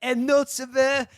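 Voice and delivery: high, slow snooty French voice; hammed-up French connoisseur, savoring; French accent. Imitating Levy sniffing farts.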